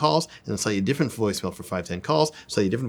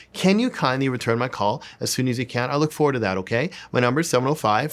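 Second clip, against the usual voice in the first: match her sweetness back at her; cute and sweet; 3 semitones higher, 3 dB louder than usual. Shown as a demonstration. Coach mirroring Cindy's sweet voicemail, bubbly. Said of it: "cute, cute, cute, sweet, sweet, sweet".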